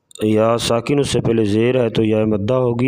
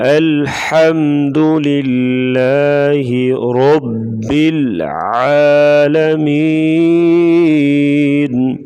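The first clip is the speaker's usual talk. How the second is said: slow, higher tajweed chant; Quran recitation; 4 semitones higher, 5 dB louder, much slower than usual. Acted. Teacher recites the verse for learners, slow sustained chant. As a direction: recite loudly with long held final vowel.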